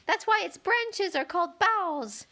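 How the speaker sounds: high, slow little flower voice; a small flower showing off what it knows; piping daisy voice. Acted. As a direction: chirp it like a smug tiny flower. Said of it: "cried a daisy".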